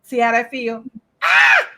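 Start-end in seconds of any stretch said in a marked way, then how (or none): 1.2-1.7 mock yelp. Imitated scream of pain.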